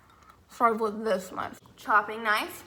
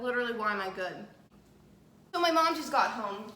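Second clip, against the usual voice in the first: ordinary talk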